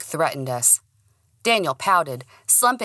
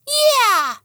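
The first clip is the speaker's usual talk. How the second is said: very high squeaky dog yell; dog cheer; 7 dB louder than usual. Acted. The dog backing up his owner, high squeaky cheer.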